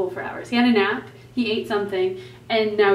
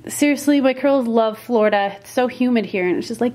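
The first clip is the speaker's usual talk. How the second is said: usual speaking voice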